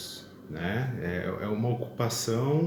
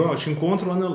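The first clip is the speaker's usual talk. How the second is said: lightly acted, louder and higher, telling a friend the plan; mock casual arrangement with a pal, voice raised; calling to a friend; 7 semitones higher, 4 dB louder, faster than usual. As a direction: toss out a pretend invitation, pitched up. Acts out arranging to meet friend.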